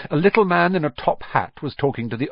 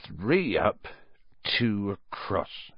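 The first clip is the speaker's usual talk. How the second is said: soft, slow counting mutter; 3 semitones lower, 6 dB quieter, much slower than usual. Acted. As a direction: count under the breath. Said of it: "he muttered".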